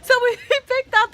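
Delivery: telling the goat story while cracking up; laughing. Real, not acted. Friend hears about the goats, laughing through it.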